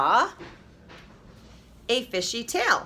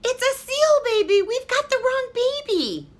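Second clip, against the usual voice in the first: very high, loud exclaiming mother; mother discovering a seal baby; dismayed mother; 4 dB louder than usual. A narrator's reading.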